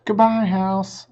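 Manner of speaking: ordinary delivery, nothing marked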